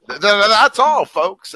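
high, loud stuttering cartoon pig; Porky Pig stutter. Pitching a Looney Tunes outro as a high Porky Pig stammer. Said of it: "the actual line from Looney Tunes".

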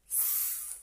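soft breathy hiss; quiet goose hiss. Acted. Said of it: "said the goose".

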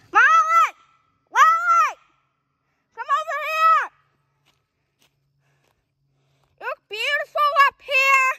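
high loud call. Real, not acted. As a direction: call across to friends far below. Calling friends up trail.

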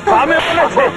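high, repeated angry rebuke; furious scolding. Acted. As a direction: rage at being answered back. Parent attacks child for answering back. (ક્રોધભર્યો ઠપકો)

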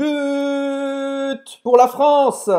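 high, slow, loud chant of "But pour la France"; mock football cheer. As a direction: cheer it like a stadium crowd. (grito de torcida brincalhão)

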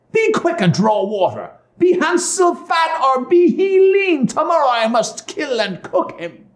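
high, commanding witch voice; impatient witch. A narrator's reading. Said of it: "she lost patience".

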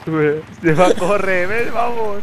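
high, urgent yells to hurry; panicking gamer. Real, not acted. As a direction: shout for your friend to get in now. Fleeing the helicopter in panic.

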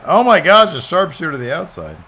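high, loud burst of amazement; excited outburst. Real, not acted. Player reacting to a sharpshooter, high loud excited cry. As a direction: blurt it out as the move lands.

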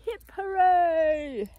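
a big drawn-out crowd hooray; shouted cheer. Acted.

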